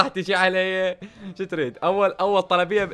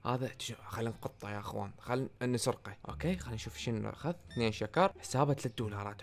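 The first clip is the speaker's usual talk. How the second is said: much softer, scheming aside; conspiratorial hush; 4 semitones lower, 10 dB quieter than usual. Pretend. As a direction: lean in and plot the theft softly. Plotting to steal from customer. (همس متآمر وهو يخطط لسرقة الزبون)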